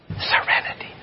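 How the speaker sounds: breathy whispered word, no voice. Real, not acted. Congregation member answering the preacher, whispered. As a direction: barely breathe the word Serenity. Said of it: "Whisper it".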